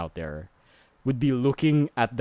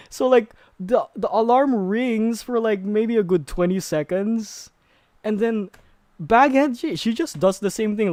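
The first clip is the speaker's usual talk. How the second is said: still worked up; 10 semitones higher, 4 dB louder than usual. Real, not acted.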